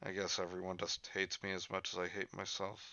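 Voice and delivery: soft, self-pitying murmur; guilt-tripping. Pretend. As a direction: put on a quiet woe-is-me to guilt someone. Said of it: "I'm trying to guilt Bagel right now".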